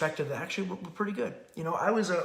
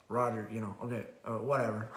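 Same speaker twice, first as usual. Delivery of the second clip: lower, low-effort brush-off; lackadaisical; 7 semitones lower, 6 dB quieter than usual. Retold or imitated. Daughter brushing off dad's orders, lackadaisical lower brush-off. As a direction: shrug it off like you barely care. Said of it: "That's lackadaisical attitude".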